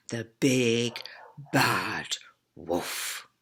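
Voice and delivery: low, whispered "bad wolf"; spooky whisper. Teacher to class about the wolf, low spooky whisper. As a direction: make the wolf sound scary in a whisper.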